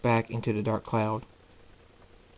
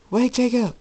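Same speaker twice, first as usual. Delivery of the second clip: high, loud shout for him to stop; calling out; 13 semitones higher, 6 dB louder than usual. Acted. Voice calls after leaving Jacob.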